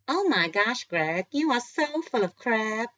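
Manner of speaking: put-on loud, high, drawn-out American accent; exaggerated American put-down, loud and slow. A narrator's reading. Lee teasing Greg about his ego, American accent. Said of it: "again in an American accent".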